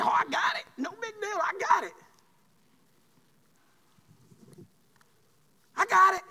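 high-pitched, dismissive brush-off; stubborn refusal. Acted. Man turns down roadside help, stubborn brush-off. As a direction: play a proud man waving off help.

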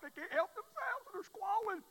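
high, loud mock squall; mock wailing. Preacher imitating a desperate caller, high and loud. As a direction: ham up their panic. Imitating people falling apart.

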